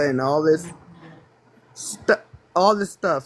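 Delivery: hiccuping mid-sentence; words broken by hiccups. Real, not acted. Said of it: "Sorry, I got the hiccups".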